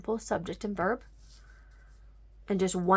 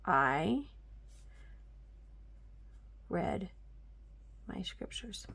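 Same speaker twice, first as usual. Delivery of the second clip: whisper; 11 dB quieter, slower than usual. Shown as a demonstration. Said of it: "See that?".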